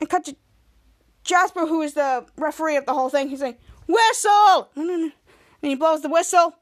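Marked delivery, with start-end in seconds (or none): 3.9-4.7 high, loud mock shout; referee's shout. Jasper refereeing the game, loud shout. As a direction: yell it like a referee.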